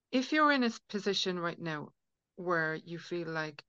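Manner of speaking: ordinary talk, nothing marked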